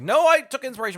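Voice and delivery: high, loud mock indignant retort; mock Tim Burton retort. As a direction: throw it back, hammed-up and defensive. Mock Tim Burton defending himself.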